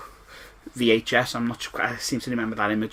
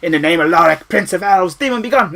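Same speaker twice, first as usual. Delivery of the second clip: put-on heroic kid battle cry; 6 semitones higher, 9 dB louder than usual. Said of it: "where he goes".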